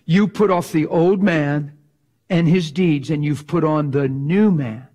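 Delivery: slow, weighty, every word stressed; emphatic slow preaching. Shown as a demonstration. Correcting the churchgoer, slow and emphatic. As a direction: lean hard on each phrase. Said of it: "No, no, no".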